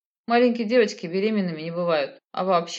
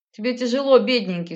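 higher, louder opening full of scorn; sneering mock pity; 5 semitones higher, 4 dB louder than usual. A narrator's reading. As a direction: pretend to pity him, sourly. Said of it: "скривилась я". (с издевательской жалостью)

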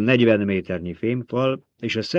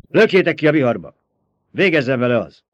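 loud, raised imperial order; angry command; 4 semitones higher, 7 dB louder than usual. A narrator's reading. Emperor orders the girl into the storm, angry command. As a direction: give a forceful execution order.

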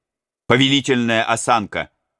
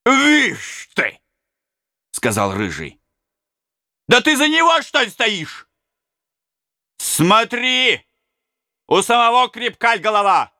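high, mocking brigand taunt; defiant robber; 14 semitones higher, 3 dB louder than usual. Red-haired robber scoffs at the prince, high and taunting.

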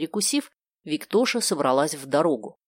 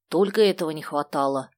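ordinary delivery, nothing marked

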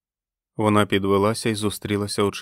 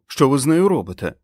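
loud, higher protesting question; alarmed shout; 6 semitones higher, 7 dB louder than usual. A narrator's reading. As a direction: demand it in alarm. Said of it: "крикнув Стю". (стривожено, підвищеним голосом)

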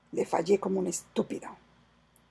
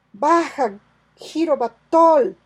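calling out; 6 semitones higher, 12 dB louder, slower than usual. Acted. Calling hidden Malay down.

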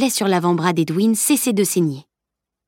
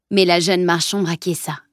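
plain reading voice

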